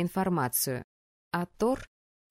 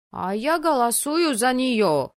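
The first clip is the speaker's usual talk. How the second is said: loud, high, drawn-out cheer; sudden exclamation; 5 semitones higher, 6 dB louder than usual. A narrator's reading. Али suddenly votes for the girl, exclaiming. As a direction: blurt it out with gusto. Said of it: "вдруг воскликнул Али".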